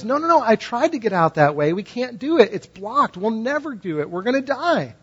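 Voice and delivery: panicked. Acted. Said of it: "And he goes".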